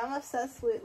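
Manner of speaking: normal talking voice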